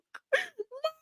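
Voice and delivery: high shrieking laugh. Real, not acted. Streamer cracks up at own joke, high laugh. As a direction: break up laughing.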